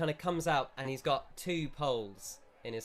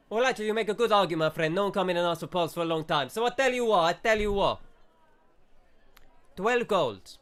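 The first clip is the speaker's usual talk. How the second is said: louder, higher, fast mock Italian shopkeeper; shopkeeper accent; 5 semitones higher, 7 dB louder, faster than usual. Shopkeeper brushes off customer, louder fast mock Italian accent. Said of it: "So he goes".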